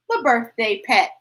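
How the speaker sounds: ordinary delivery, nothing marked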